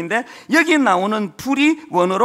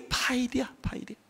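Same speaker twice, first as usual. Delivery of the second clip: tender whisper; 10 dB quieter than usual. Acted. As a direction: whisper "파이디야" softly, almost breathless. Tender whispered word of love. (아버지처럼 "파이디야"를 속삭이듯)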